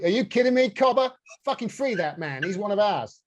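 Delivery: high, indignant hammed-up Aussie; bad Australian accent. Kangaroo demanding they free that man, bad Australian accent. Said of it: "worst Australian accent ever".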